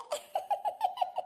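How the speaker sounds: high-pitched teasing giggle; high mocking laughter. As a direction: giggle in a high voice at someone's bad luck. Teasing the older woman's misfortune.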